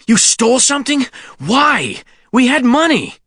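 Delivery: high, winded disbelief; labored voice. A narrator's reading. Sean challenges Kenny over the theft, labored voice. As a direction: demand answers while catching your breath. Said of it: "Sean's voice was labored".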